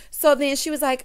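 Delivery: ordinary talk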